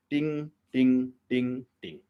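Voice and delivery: repeated ting, one dull guitar string; plucked string imitation. Shown as a demonstration. Teacher showing students one boring string, plucked ting sound. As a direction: mimic a single string plucked again and again. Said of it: "सिर्फ एक तार ही बजा रहे हैं".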